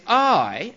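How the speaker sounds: a single I, high and loud; stress on I. Shown as a demonstration. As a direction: punch out I on its own. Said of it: "again with this emphasis".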